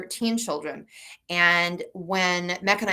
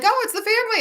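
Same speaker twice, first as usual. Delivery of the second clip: much higher, louder joyful exclaim; father's delighted cry; 5 dB louder, faster than usual. Retold or imitated. Father spotting the relatives' van, high cheerful cry. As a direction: sing out a happy greeting. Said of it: "my father would be like".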